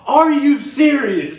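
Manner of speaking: high, slow, loud disbelief; outraged disbelief. Retold or imitated. The plane cuts off the movie, outraged disbelief. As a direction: stretch it out in shock.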